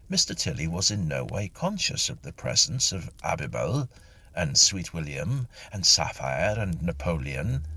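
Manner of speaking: usual speaking voice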